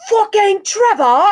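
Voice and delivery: loud, high, slow mock British yell; British accent yell. Quoting knockoff [name] kicking in a door, loud mock British yell. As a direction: belt it out in a hammed-up British accent. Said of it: "yells in his British accent".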